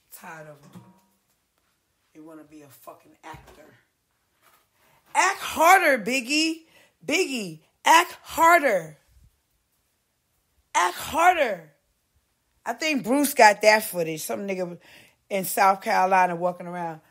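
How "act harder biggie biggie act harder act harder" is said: loud, high, mocking shout; mock director yell. Mocking a set director, loud and high. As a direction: bark it like a pushy director.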